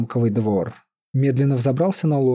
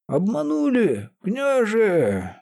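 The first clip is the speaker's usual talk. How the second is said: high, slow panicked call; alarmed cry; 9 semitones higher, much slower than usual. A narrator's reading. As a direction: cry out the bad news. Seer runs in, alarmed.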